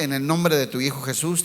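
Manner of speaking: ordinary talk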